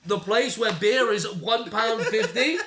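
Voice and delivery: slow, high, comic disbelief; incredulous. Retold or imitated. Arguing with his mate about cheap beer, incredulous. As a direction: stretch it out in baffled protest, half laughing.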